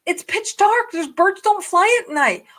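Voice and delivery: high, insistent, panicky protest; frantic. Retold or imitated. Arguing with dad on the phone, frantic and high. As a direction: stutter it out in rising alarm. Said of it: "I go".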